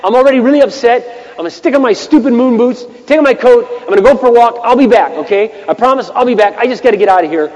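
acted-out huffy announcement, pitched high. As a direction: warn your wife you're storming out. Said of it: "still kinda huffy".

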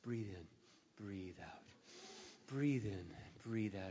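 calming himself after bad news; calming breaths. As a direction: talk yourself down quietly. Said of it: "I'm trying, put calm".

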